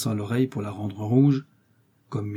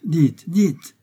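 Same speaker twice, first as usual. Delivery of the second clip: higher, louder urging; keen, raised-voice prompting; eager exclamation; 7 semitones higher, 6 dB louder than usual. A narrator's reading. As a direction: urge him on brightly, pitch jumping up. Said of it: "s'écria D'Artagnan".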